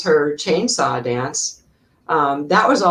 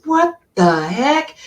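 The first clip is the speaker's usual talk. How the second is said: high, slow "what the heck"; startled outburst; 7 semitones higher, slower than usual. Retold or imitated. Actress opening the door, shocked and high. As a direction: bark out a shocked "what the heck" as the teeth fly. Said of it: "and she goes".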